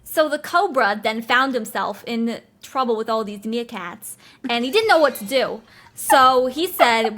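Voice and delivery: heavy New York accent, storytelling; put-on New York voice, a laugh creeping in. Shown as a demonstration. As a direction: tell it like a New York wiseguy. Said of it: "I'll do New York accent".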